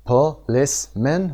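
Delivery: "Policeman" in separate syllables, po-LICE-man; stress on second syllable. Shown as a demonstration. Teacher splits "Policeman" for students to show stressed LICE. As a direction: break up "Policeman", lifting LICE.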